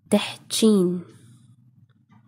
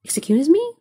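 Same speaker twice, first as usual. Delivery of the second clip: puzzled question; 9 semitones higher than usual. Acted. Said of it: "So you reply".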